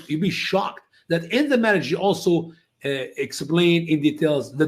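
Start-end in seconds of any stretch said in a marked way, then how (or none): none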